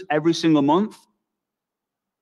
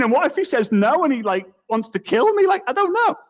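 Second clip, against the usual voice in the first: nervous worry; 8 semitones higher than usual. Retold or imitated. Fearful thoughts rising.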